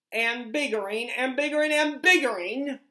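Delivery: the Onceler yelling ever bigger; building yell. Acted. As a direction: ramp up with every repeat. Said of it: "I yelled at the Lorax".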